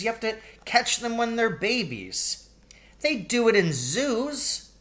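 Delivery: put-on high kid's voice; child arguing. A narrator's reading. Billy and Paul bickering about wild animals, high child voice. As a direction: argue like a little boy. Sibling argument.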